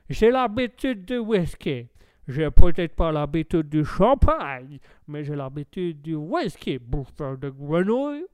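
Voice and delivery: put-on slow, drawling American-accented French; hammed-up boozy American. American boasting of whisky to a Frenchman, boozy American accent. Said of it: "bel accent de bouffeur d'hamburger".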